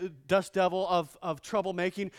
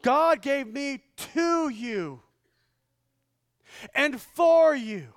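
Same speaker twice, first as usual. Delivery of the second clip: slow, higher and louder, fervent proclamation; voicing Jesus; 8 semitones higher, 8 dB louder, slower than usual. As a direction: proclaim it slowly like Jesus to the crowd. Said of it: "Jesus says".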